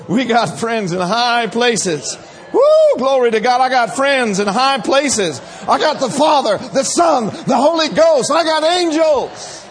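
loud, high shouted praise, slow and emphatic; preaching at full force. Acted. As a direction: shout the victory to the church.

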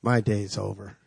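low, soft aside as if thinking it; hushed retold inner voice; unspoken thought. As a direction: imitate a private sinking thought, quietly. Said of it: "Thought. Didn't give voice to it".

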